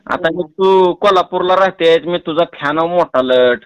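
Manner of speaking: normal talking voice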